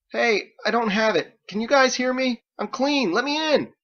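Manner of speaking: high, loud plea through a door; begging to be let in; desperate calling. Acted. Drake calls through the door to be let in, high and loud.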